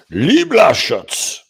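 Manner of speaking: loud, high, drawn-out proclamation of the name; with authority. Real, not acted. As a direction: belt the name out commandingly. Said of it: "now I say it with authority".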